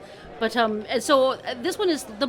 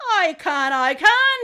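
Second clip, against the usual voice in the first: high, loud, rising insistence; eager insistence; 6 semitones higher, 8 dB louder, slower than usual. Acted. Crow accepting the other's dare, insistent. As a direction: insist twice, the second bigger.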